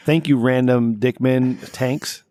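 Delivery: drawn-out, slow shout-out; slow announcer thanks. Retold or imitated. Host mock-thanking a caller, slow and drawn out. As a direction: stretch out the thank-you slowly. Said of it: "well, like".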